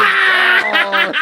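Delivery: high shrill mock jeer; a hammed-up schoolyard taunt; cartoonish kid shout, pitched very high. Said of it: "the class was like".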